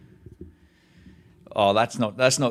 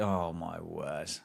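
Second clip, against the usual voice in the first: slow, much softer oh my word; hushed disbelief; 4 semitones lower, 10 dB quieter, much slower than usual. Real, not acted. Realising the dressed-up fans are Australian, hushed disbelief. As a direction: let it out slowly under your breath.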